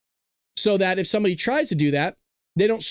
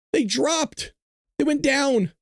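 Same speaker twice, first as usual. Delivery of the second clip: amused disbelief; 6 semitones higher, slower than usual. Real, not acted. Amazed prices dropped.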